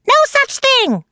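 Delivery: very high screeching denial; scream. A narrator's reading. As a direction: scream it in shrieking protest. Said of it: "screamed Sammy".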